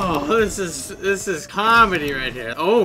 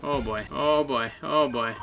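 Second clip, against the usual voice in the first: usual speaking voice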